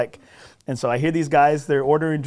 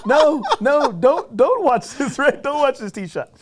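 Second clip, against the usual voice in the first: much higher, slower, laughing mock panic; panicked inner plea; 11 semitones higher, 4 dB louder, slower than usual. In his head begging a stranger not to watch, mock panicked. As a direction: beg him not to watch, half laughing.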